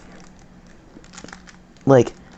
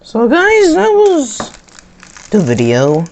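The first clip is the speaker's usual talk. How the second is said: loud, high, bursting outro; excited outro; 10 semitones higher, 4 dB louder than usual. Real, not acted. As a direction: shout the sign-off with energy.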